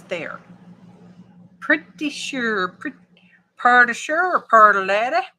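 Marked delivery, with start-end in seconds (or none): 3.6-5.2 loud Far East Texas twang, "purdy"; Far East Texas accent. Shown as a demonstration. Crafter showing how Far East Texas talks, broad accent. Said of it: "That's how they talk in Far East Texas".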